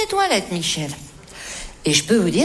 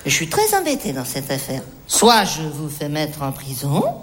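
tender confession; 10 semitones lower than usual. Acted. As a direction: confide it gently.

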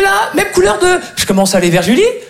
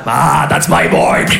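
proud American director; 4 semitones lower, much slower than usual. Retold or imitated. Director pleased.

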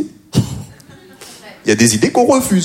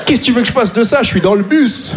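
high-pitched exasperated protest; mock exasperation; 10 semitones higher than usual. Exasperated reply to motivational meme.

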